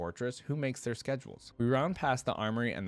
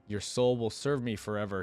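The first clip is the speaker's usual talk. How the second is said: ordinary delivery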